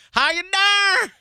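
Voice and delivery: slow, high, loud drawn-out flirt; catchphrase on request. Shown as a demonstration. Asked to repeat it, slow, high and loud. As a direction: stretch the cheesy greeting. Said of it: "Say it again".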